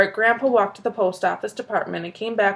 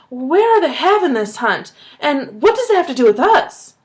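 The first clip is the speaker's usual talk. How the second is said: louder and higher, eager; eager question; 6 semitones higher, 4 dB louder than usual. A narrator's reading. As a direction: ask it wide-eyed and keen. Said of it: "Wide-eyed".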